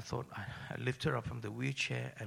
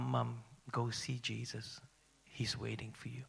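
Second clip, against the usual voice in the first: tender whisper; 5 dB quieter than usual. Retold or imitated. Son lets his dying mother go, soft whisper. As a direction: let her go gently, almost breathless.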